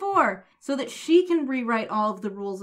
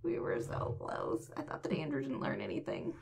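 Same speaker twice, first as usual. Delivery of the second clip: whispered mock despair, much lower; 9 semitones lower, 11 dB quieter than usual. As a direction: breathe it out in mock defeat. Mock despair after the joke.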